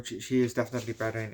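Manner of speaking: ordinary talk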